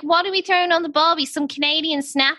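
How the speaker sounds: put-on a light, fast Australian barbecue accent; Aussie accent. Host mocks a barbecue line in a light Aussie accent. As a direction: lightly toss off an Aussie barbie line, quick. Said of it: "I forgot you can do an Aussie accent".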